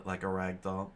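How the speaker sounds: ordinary delivery, nothing marked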